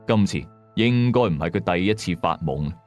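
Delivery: ordinary delivery, nothing marked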